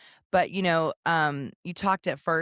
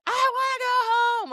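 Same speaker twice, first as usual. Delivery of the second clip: very high, squealed imitation of a child's tantrum; mock screaming; 4 dB louder, faster than usual. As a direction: mimic a kid wailing for everyone to hear. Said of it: "everybody can hear him".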